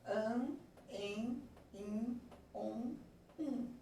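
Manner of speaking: "An, en, in, on, um" as isolated nasal vowels; "An, en, in, on, um" recited slowly with gaps between; nasal vowel sounds. Shown as a demonstration. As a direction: demonstrate each sound in "An, en, in, on, um" on its own. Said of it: "a tradução fonética delas é isso aqui".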